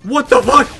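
very high, very loud shriek; terrified scream. Real, not acted. Gamer swarmed by clowns, screaming in fright.

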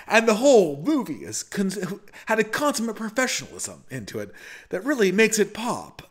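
high, swooping mock-critic delivery; Patrick Bateman. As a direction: parody the American Psycho killer's earnest review. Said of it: "part of the Patrick Bateman routine".

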